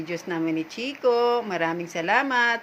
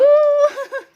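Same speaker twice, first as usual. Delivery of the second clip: loud shrieking laugh; 11 dB louder than usual. Real, not acted. Dog antics during play.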